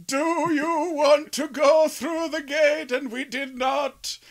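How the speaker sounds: slow, high character voice; drawn-out storyteller NPC. Acted. NPC speaks to the party.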